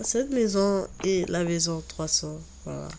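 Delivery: ordinary delivery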